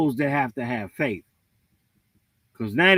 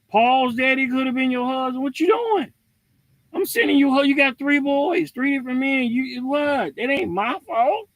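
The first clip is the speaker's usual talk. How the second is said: God scolding; 8 semitones higher than usual. Retold or imitated. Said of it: "Now he going".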